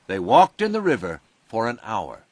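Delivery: ordinary delivery, nothing marked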